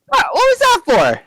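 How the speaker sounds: very high, startled squawk of indignation; high-pitched affronted cry; indignant protest. Acted. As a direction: protest as if just slapped for nothing. Dwight protests after being slapped.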